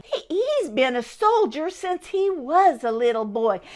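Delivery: ordinary delivery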